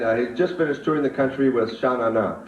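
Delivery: ordinary delivery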